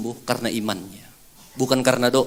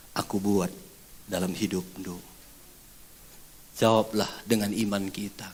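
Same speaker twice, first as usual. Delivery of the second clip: intimate whisper; 7 semitones lower, 8 dB quieter than usual. Acted. Preacher to congregation, hushed and intimate. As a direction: draw the congregation in, barely above a whisper.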